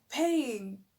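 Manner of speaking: stunned at the word paying; shocked echo; incredulous. Retold or imitated. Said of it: "I'm like".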